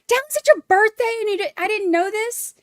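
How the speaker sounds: high, bright shock at the news; excited birthday surprise. Real, not acted. Streamer finds out a chatter's birthday, excited high squeal.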